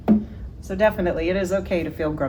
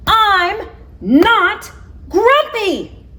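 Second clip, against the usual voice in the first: much higher, louder, slow furious shout; 9 dB louder, much slower than usual. Acted. Jim shouting at all the animals, furious. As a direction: roar it while beating your chest. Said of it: "shouted Jim".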